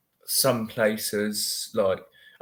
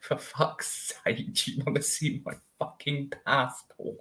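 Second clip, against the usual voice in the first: exasperated; 2 semitones higher, 6 dB quieter than usual. Retold or imitated. Answering Americans who won't listen, exasperated. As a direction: drag it out, utterly done.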